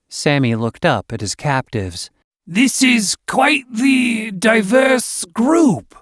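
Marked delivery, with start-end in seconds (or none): 2.5-5.9 slow, drawn-out hiss on the words; hissing snake man. A narrator's reading. Snake creature eyeing his captives, slow and hissing. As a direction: savour each word like a hungry serpent. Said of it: "Oh, stop with the hissing".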